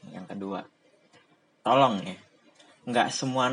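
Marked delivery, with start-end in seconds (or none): 0.1-0.6 "kedua" dropped soft and low; quiet repeat. Real, not acted. Repeating his second point to himself, quiet. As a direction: mutter "kedua" softly. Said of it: "yang kedua nih".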